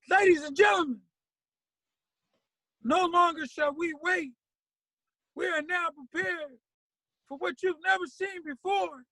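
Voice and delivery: high, loud booming show intro; stage announcer. Retold or imitated. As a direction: announce the band like a hype MC.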